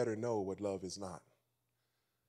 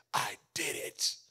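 slow, hushed proud little son; little boy voice; 4 semitones lower, slower than usual. Retold or imitated. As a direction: whisper it like a proud small boy. Son's proud words retold.

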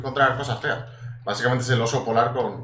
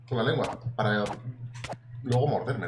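ordinary talk, nothing marked

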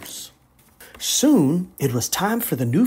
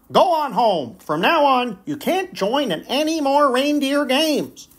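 harsh coach; 10 semitones higher than usual. Acted. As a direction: send him away coldly. Coach banishes Rudolph.

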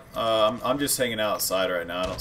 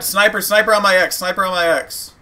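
urgent callout; 7 semitones higher, 7 dB louder than usual. Real, not acted. Death callout to team.

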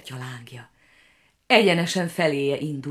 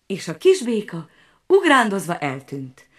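ordinary delivery

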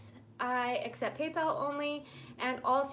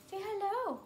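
high coaxing "Say hello."; sing-song, higher "Say hello." to the dog; 7 semitones higher than usual. Real, not acted. Coaxing dog to greet viewers.

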